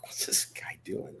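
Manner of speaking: slow, breathless whisper; whispered thought. Retold or imitated. Recruit wondering about the instructor beside him, whispered. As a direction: whisper it to yourself, puzzled. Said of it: "I'm like".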